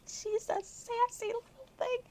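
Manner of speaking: high laughing voice; amused high aside. Real, not acted. Reader tickled by Jin, high laughing aside. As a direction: say it through laughter.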